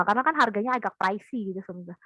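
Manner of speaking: usual speaking voice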